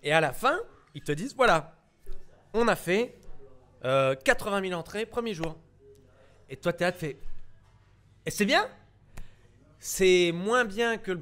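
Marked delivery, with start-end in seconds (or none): 1.4-5.6 loud and slightly higher proclamation; announcer tone. Acted. Distributor announcing first-day admissions, loud and proud. 8.2-8.7 high, loud puzzled rise; naive question. Acted. Newcomer asking the distributor about the numbers, high and puzzled.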